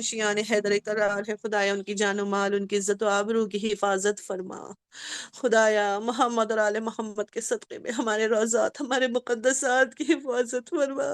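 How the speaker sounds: low, soft, pleading supplication; closing dua of the majlis; prayer. Real, not acted. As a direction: pray quietly for the congregation.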